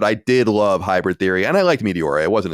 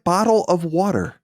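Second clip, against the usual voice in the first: British glottal stops in "bottle of water"; clipped British "bo'le of wa'er"; British accent demo; 8 semitones higher, slower than usual. Shown as a demonstration. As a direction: drop the t's like a Londoner. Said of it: "that's the classic".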